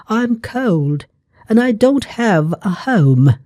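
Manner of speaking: Thumbelina begging the butterfly, high and small; tiny girl pleading. A narrator's reading. Girl tells mouse she's cold.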